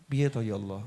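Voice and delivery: low, weary plea to God; acted helpless murmur; dizzy with worry. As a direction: lament softly, at a loss. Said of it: "Menggambarkan mumetnya orang-orang".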